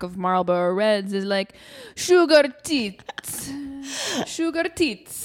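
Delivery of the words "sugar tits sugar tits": put-on slow, higher, drawn-out Italian-accented "Sugar tits". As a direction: say "Sugar tits" slowly like a Speedo-clad Italian. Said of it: "Does that sound Italian?".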